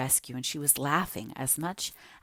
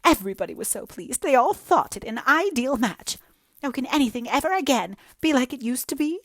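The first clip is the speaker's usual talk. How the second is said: high, loud, self-pitying lament; jilted girl pouring out woe to Anne; melodramatic young woman; 10 semitones higher, 5 dB louder than usual. Acted. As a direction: wallow in every word like a tragic heroine.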